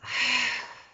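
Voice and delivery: long breathy sigh out; audible exhale sigh. Shown as a demonstration. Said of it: "sigh it out".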